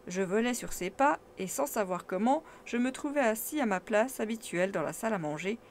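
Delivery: plain reading voice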